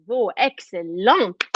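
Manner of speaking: normal talking voice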